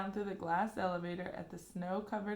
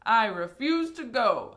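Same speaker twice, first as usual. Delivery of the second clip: announced; 4 semitones higher, 12 dB louder than usual. A narrator's reading. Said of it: "announced Grandpa George".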